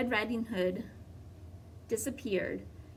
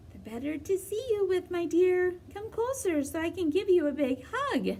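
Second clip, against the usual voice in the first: fake grandmother voice; 9 semitones higher, 3 dB louder than usual. Wolf posing as grandmother.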